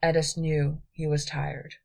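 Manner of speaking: ordinary delivery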